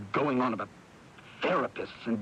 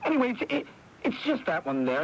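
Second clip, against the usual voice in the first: higher, stumbling and anxious; nervous rising fluster; agitated stammer; 11 semitones higher than usual. Acted. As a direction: fumble the words, getting worked up over the chair. Troy agitated about the chairs.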